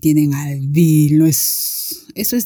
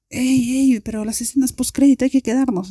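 higher-pitched imitation of friends urging; insistent classmates; 7 semitones higher than usual. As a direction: plead to stay, in a lightly mocking high voice. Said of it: "Dos compañeras estaban así como que". (imitando a compañeras insistentes, voz más aguda)

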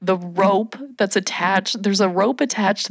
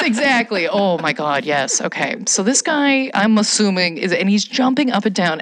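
ordinary talk, nothing marked

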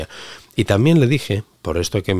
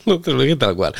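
laughing; 8 semitones higher, 4 dB louder than usual. Real, not acted. Laughs taking it back.

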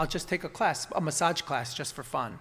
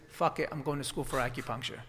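usual speaking voice

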